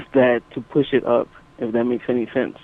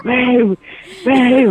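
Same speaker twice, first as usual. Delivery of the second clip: mock screaming; 10 semitones higher than usual. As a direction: yell for him across the room. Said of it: "she's all screaming".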